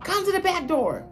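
very high put-on voice of the pushy manager; assistant manager voice. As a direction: squeak it out like the nagging boss. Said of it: "she pulled me to the side".

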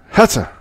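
high, loud sneeze sound. A narrator's reading. A man sneezes loudly in a bar, high and loud.

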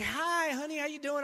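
lightly voiced wife, all sweetness; sweet wife.

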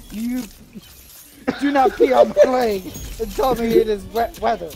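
ordinary talk, nothing marked

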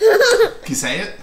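giggling high question; laughing. Real, not acted. Laughs at toddler with full mouth.

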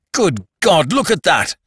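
high, loud shocked cry. A narrator's reading. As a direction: cry out at the sight. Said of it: "and crying".

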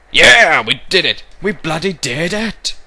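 high, loud victory shout; excited cheer. Real, not acted. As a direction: celebrate the win. Game win.

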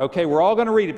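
usual speaking voice

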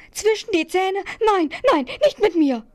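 high, loud squeal of panic; terrified little letter shrieking its refusal; horrified squeak. A narrator's reading. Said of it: "entsetzt quietschte der Brief auf".

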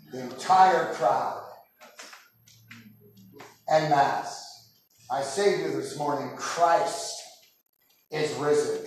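ordinary talk, nothing marked

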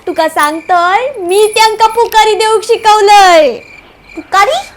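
usual speaking voice

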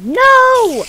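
high loud "No!"; anguished cry. Real, not acted. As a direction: cry out at the loss. Loses again.

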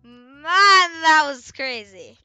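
loud, drawn-out exclamation; amazed outburst. Real, not acted. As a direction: stretch it out loudly in amazement. Reacting to the game just played.

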